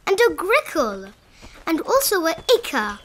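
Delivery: plain reading voice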